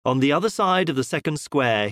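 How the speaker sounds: ordinary delivery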